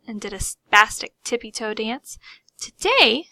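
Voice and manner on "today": high, louder squeak. A narrator's reading. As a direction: squeak the word out. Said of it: "she squeaked".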